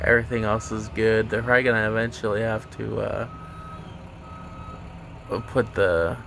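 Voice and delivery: speaking with lip held open; finger pulling lip down. Real, not acted. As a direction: finger at mouth corner. Seen 5: Finger holds lower lip down, showing braces, throughout the words.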